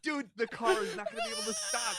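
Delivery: high, loud panicked cry; mock panic. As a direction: imitate a passenger crying out in alarm. Retold panic in runaway car.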